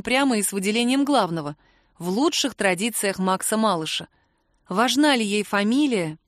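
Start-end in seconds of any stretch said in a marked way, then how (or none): none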